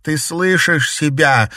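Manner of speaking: high, loud and slow, pleading; desperate cry. A narrator's reading. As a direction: cry out in despair. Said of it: "отчаянно крикнула Маргарита".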